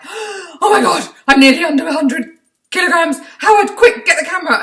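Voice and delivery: high, loud thrilled squeal over the scales; excited squeal. Retold or imitated. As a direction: shriek with delight, calling her husband. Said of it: "And I was like".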